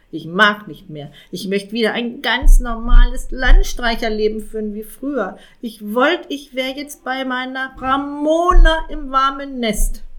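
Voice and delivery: lightly voiced grumbling raven, done with it all; a hint of a fed-up raven. A narrator's reading. Raven Jakob tells the cat he quits, fed up. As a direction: raven throwing in the towel, slowing at the end.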